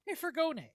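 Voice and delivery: furgone said the Italian way, fur-GO-ne, with a rolled r and the stress on GO; Italian pronunciation. Shown as a demonstration. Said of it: "as it would be pronounced in Italian".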